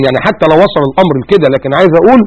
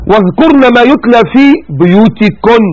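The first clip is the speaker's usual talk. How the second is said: higher, slow chanted recitation; Quran recitation; 5 semitones higher, slower than usual. Acted. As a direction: chant the verse slowly. (تلاوة مرتلة بطيئة)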